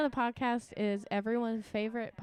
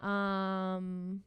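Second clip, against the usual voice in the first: slow slogan; much slower than usual. Pretend. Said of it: "slogan delivery".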